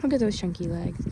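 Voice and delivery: ordinary talk, nothing marked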